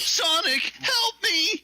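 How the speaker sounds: high-pitched plea for help; high cry for help. Pretend. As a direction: cry out to Sonic, panicked. Voicing a character calling Sonic.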